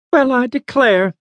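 put-on high, charmed old lady. A narrator's reading. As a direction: say it as a surprised old woman. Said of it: "the old lady said".

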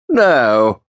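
put-on old official's sharp greeting. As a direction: snap out a cold greeting to the lawyer. Roland's voice.

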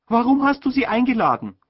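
put-on high, loud complaining young woman; a sister's high fretful question; voiced sister. A narrator's reading. Sister fretting to the mother, voiced high and loud.

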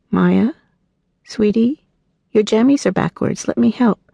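put-on high, gentle voice for a toddler; sweet coaxing. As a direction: coax the little one kindly. Mother fixing child's pajamas.

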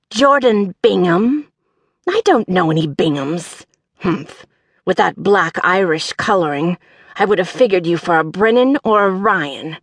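gravelly, over-enunciated, dismissive character voice; peculiar gravel voice. A narrator's reading. Employer dismisses the applicant, peculiar gravelly voice. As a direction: draw out the name, then dismiss in a gravelly voice. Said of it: "a peculiar voice".

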